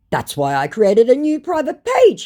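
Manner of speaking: loud, high-pitched mock declaration; mock scammer. Scammer defends his new page, mock emphatic. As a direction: put on an emphatic scammer reply. Scammer's boastful message acted out.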